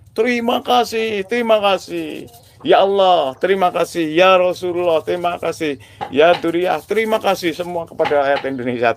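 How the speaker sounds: high, gushing "terima kasih" over and over; effusive high thanks. Real, not acted. Thanking God, the Prophet and the people, gushing and high-pitched.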